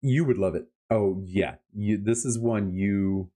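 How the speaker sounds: usual speaking voice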